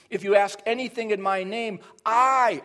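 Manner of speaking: "I" set apart and punched; emphatic I. Shown as a demonstration. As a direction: build to a loud, high "I". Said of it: "again, that's emphatic".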